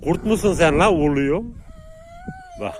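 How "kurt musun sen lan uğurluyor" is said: loud, higher teasing yell at the dog; teasing shout. Real, not acted. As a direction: shout at the dog like it's a wolf.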